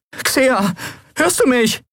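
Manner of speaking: hoch, panisch, Stimme kippt; überschlagende Stimme. A narrator's reading. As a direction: verzweifelt nach ihr rufen. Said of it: "Seine Stimme überschlug sich". (Stimme überschlägt sich vor Angst)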